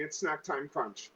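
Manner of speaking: normal talking voice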